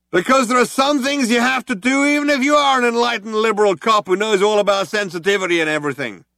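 loud, high, earnest ultimatum; reasonable cop with a threat. A narrator's reading. Cop giving the ultimatum over the hailer, loud and high. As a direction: offer the choice politely.

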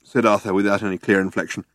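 usual speaking voice

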